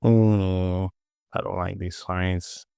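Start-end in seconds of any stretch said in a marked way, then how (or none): none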